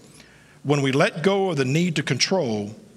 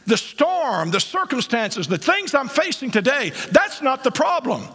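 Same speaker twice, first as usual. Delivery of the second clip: full-force preaching; 11 semitones higher than usual. Acted. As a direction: preach it to the rafters with rising fire. Preaching climax.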